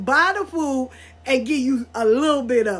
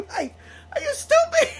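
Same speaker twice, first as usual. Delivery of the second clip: laughing disbelief, pitched way up; laughing mock shout. Real, not acted. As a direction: shriek it through real laughter. Laughing mockery of their assumption.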